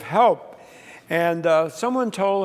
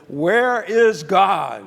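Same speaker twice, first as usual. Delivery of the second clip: slow, louder, stern questioning; 3 semitones higher, 6 dB louder, much slower than usual. Retold or imitated. Minister asking the silent boys once again, stern. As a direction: press the question sternly, drawing it out. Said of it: "he looked at them very sternly".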